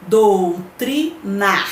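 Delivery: doutrinar split into slow, separate syllables; teacher's slow syllable breakdown of "doutrinar". Shown as a demonstration. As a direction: sound out "doutrinar" slowly, pausing between syllables. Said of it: "só que separando a sílaba".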